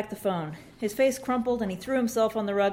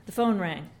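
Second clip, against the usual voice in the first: ordinary delivery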